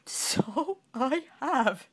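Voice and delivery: king giggling with surprise. Acted. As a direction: let the giggles bubble up. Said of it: "he giggled".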